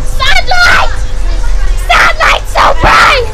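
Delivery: high, loud, slowed shout about the bright sunlight; shouting at the sun. Real, not acted. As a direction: cry out as the sun hits your eyes. Dazzled by sunlight outside.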